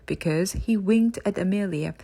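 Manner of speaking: ordinary delivery, nothing marked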